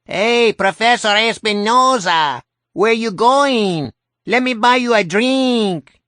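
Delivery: high loud cartoon Mexican accent; Speedy Gonzales. A narrator's reading. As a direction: call out in a hammy Mexican accent. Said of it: "in another hideous Speedy Gonzales accent".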